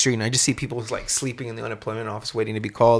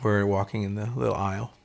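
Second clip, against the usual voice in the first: ordinary talk, nothing marked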